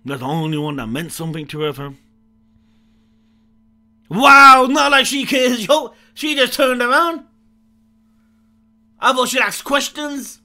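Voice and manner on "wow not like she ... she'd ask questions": loud, high, stunned outburst; excited shouting. Real, not acted. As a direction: shout it out in disbelief. Shocked reaction to game character.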